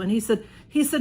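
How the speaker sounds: usual speaking voice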